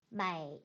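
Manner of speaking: 美 held low without rising; half third tone. Shown as a demonstration. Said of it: "낮춰 부르는 반삼성이 되고요".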